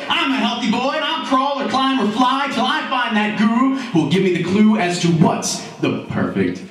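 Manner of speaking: high-pitched boast, dropping on the last words; determined Roy. Acted. Roy vows to climb to the guru, high and determined. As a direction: declare it like an eager seeker.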